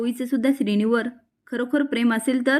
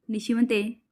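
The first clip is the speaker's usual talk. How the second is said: plain reading voice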